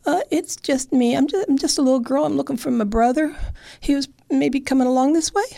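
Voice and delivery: put-on small girl's voice, pitched high and hesitant; little girl. Little girl answering the bear on the bridge, high and hesitant. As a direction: stammer it out like a timid little girl. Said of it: "And she said".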